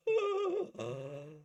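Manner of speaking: sharp high gasp; cat's shocked gasp. Retold or imitated. The cat seeing him suffocated, high gasp. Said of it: "he's like".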